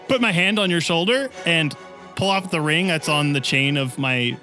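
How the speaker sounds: ordinary talk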